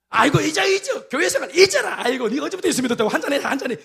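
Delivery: mocking father. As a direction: play the dad mocking his son's faith, high-pitched. Father voiced urging to forget church. (교회를 비웃는 아버지 흉내, 높은 톤)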